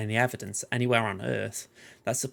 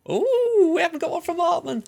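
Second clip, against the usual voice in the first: much higher and louder, thrilled squeal; excited squeal; 6 dB louder than usual. Real, not acted. A fan spotting Hartman's name, squealing with real excitement. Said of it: "I was that excited about Hartman".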